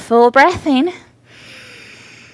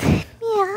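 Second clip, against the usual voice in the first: high soft cat meow; playful meow; 9 semitones higher, 7 dB quieter than usual. Shown as a demonstration. Mother meows to baby in cat pose, high and soft. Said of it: "Maybe make some sound".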